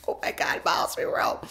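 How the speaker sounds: starstruck whispered squeal without voice; giddy whisper. Retold or imitated. Fan in the corner spots her idol, giddy whisper. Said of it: "like a giddy little kid".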